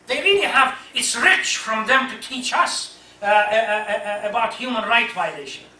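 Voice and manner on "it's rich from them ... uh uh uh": normal talking voice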